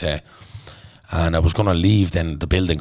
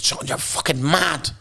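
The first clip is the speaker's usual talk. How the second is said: high, slow mock teacher voice; imitating the teacher; 10 semitones higher, slower than usual. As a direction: drawl it out high, half amused and half shocked. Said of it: "And she said".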